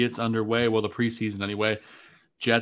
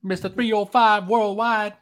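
higher, slow, hyped-up hype-man shout; 10 semitones higher, 5 dB louder than usual. Retold or imitated. Cohost shouting [name]'s catchphrase, hyped-up and slow. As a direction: shout it like a Miami rapper.

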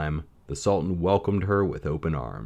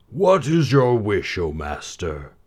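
a jolly booming genie; ring genie voice; 3 semitones higher, 4 dB louder, slower than usual. A narrator's reading.

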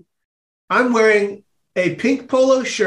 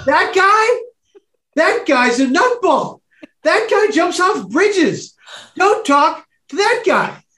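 put-on whiny teen kid; 10 semitones higher, slower than usual. Kid warning his cousin away from the volunteer, whiny and high. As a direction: warn her off him like a bratty kid.

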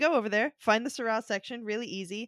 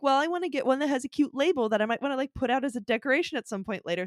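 quick musing; 2 semitones higher, faster than usual. Retold or imitated. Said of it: "And I was like".

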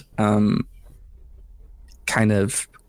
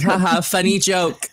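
mock casual; 7 semitones higher than usual. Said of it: "it being casually like".

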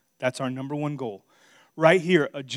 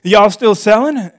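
repeated Missouri twang on y'all, higher and louder; Missouri drawl; 7 semitones higher, 15 dB louder than usual. Retold or imitated. Preacher repeating the buyer's words, Missouri accent. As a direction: say it again like a Missouri local. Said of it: "That's how they talk in Missouri".

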